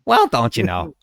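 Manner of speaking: put-on Minnesota accent. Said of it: "your Minnesotan accent was terrible".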